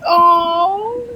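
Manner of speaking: mock surprised oh. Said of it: "I'm like".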